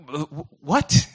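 mock flabbergasted, tripping into the word; stunned disbelief. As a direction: stutter it out like a baffled listener. Acting out the mother's stunned reply.

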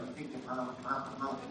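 "murmur" said low and soft, like a murmur; "murmur" repeated low and hushed like a grumble; murmuring the word. Shown as a demonstration. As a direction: let "murmur, murmur" sink into a low hum. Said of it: "one of those words when you hear it".

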